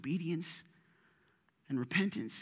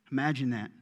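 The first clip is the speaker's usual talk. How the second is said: ordinary delivery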